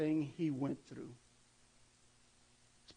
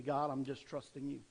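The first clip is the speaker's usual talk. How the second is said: soft, quiet, humble "trusting you"; quiet sincere prayer; 5 semitones lower, 9 dB quieter than usual. Retold or imitated. Believer quietly handing worries to God. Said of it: "Or you could say".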